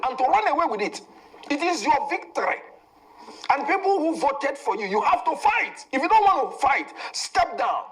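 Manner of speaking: high-pitched, insistent political rant; real fired-up plea, pitch climbing as it builds. Real, not acted. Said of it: "you don't need to scream".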